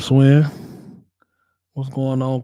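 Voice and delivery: ordinary talk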